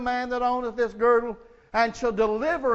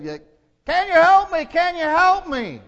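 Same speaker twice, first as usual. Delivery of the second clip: much higher, louder, pleading cry; desperate plea; 8 semitones higher, 6 dB louder than usual. Acted. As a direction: beg for rescue, panicked. Tourist dangling, begging for help.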